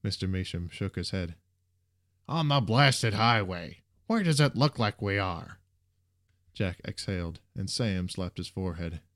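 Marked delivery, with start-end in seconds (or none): 2.3-5.6 irritated retort. A narrator's reading. Peeved bus driver answers a student's question, irritated. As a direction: answer as if the question were stupid.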